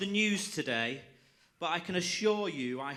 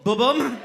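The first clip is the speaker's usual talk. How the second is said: loud spoken drum rimshot; loud ba-bum sting; 8 semitones higher, 14 dB louder than usual. Acted. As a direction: mouth the drum sting loudly. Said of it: "punchline drum sound".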